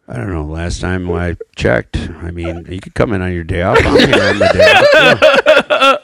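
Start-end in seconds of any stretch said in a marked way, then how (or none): 3.8-6.0 high laughing protest. Real, not acted. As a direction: laugh through it. Laughing complaint.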